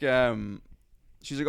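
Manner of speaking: ordinary talk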